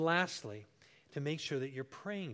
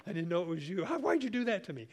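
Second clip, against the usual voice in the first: mock startled shriek, much higher and louder; 6 semitones higher, 10 dB louder than usual. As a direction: imitate a wife jumping out of her skin. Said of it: "she just comes unglued".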